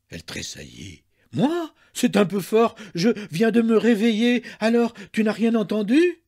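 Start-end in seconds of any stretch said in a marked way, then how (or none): 1.3-6.2 high, loud mock innocence; affronted, raised voice; indignant protest. A narrator's reading. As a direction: protest loudly, feigning surprise. Wife feigns indignant surprise.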